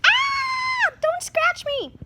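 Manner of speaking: very high shrieking plea; mock fright. Child pretending rat scratches her, high shriek.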